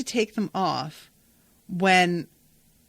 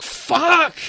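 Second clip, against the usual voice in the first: high, loud, shocked curse; shocked shout; 9 semitones higher, 7 dB louder than usual. Retold or imitated. Family hearing the scones news, shocked loud curse. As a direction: blurt it out in shock.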